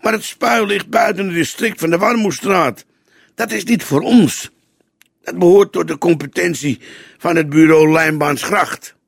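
a touch of worked-up objection; slightly emotional. A narrator's reading. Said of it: "zwaaide licht geëmotioneerd".

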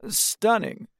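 high, vain and admiring. Acted. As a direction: gush over your reflection. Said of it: "Vanity says, admiring his reflection".

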